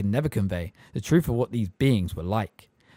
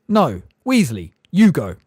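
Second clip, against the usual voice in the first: loud, curt bark; barked order; 5 semitones higher, 9 dB louder than usual. A narrator's reading. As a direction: bark it at the assistant. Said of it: "Fudge barked at Percy".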